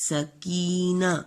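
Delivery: سکینہ stretched slowly; سکینہ drawn out for pupils; drawn-out model word. Shown as a demonstration. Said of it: "اس میں بھی چھوٹی اے".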